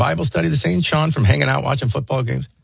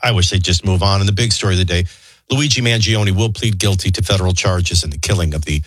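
low-pitched radio newsreader; news read; 10 semitones lower than usual. Acted. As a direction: deliver the headlines in a low, measured register. Reads the news headlines.

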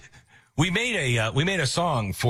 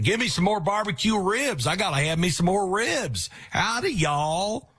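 put-on Texas accent; 10 semitones higher than usual. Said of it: "now he's all Texan".

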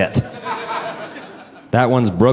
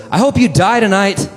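high, matter-of-fact curse; homeless woman cursing; 10 semitones higher than usual. Retold or imitated. Homeless woman to the man who refused, high curse. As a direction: wish death on him lightly. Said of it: "She goes".

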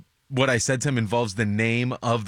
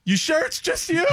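high, wary question with a laugh; half-laughing paranoid check; comic locked in the green room; scared and suspicious. Retold or imitated. Comic hiding behind locked door.

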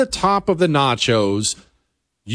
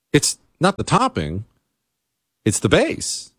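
normal talking voice

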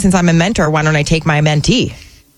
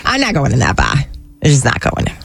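put-on Boston accent, lower pitch; Connie from Boston; 4 semitones lower than usual. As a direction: refuse like a Boston local, dropping the r's. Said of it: "What would Connie from Boston say".

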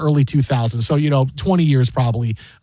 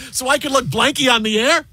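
lightly imitated retort, pitched up; a hint of the indignant analyst, pitched up; Barry Melrose; 8 semitones higher than usual. Analyst blurting a swear on air, mild mock retort. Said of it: "He goes".

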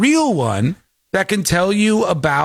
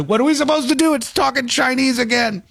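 mock panicked tech guy; 7 semitones higher than usual. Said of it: "tech guys going".

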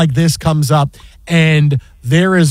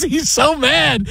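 ordinary delivery

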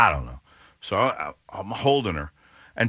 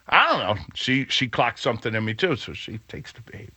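ordinary talk

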